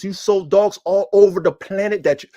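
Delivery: ordinary delivery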